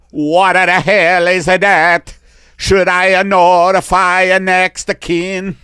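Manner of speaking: put-on Italian alien accent. Italian alien telling the doctor his first name, Italian accent. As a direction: introduce yourself grandly in Italian. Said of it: "Italian alien".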